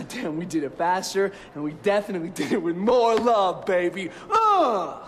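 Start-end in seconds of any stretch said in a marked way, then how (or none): 4.3-5.0 loud, high exclaimed grunt; loud triumphant grunt; emphatic grunt. Acted. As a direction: punch out a cocky "uh". Triumphant grunt after boast.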